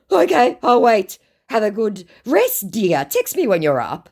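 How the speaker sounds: high, loud start, mock solicitous; scammer's put-on voice. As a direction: humour her as the scammer.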